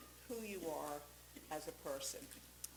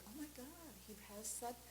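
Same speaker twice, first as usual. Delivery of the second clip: soft, higher-pitched awe; hushed admiration; 7 semitones higher, 9 dB quieter, faster than usual. Retold or imitated. Admirer reading his Facebook posts, hushed awe. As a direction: think it aloud in quiet wonder.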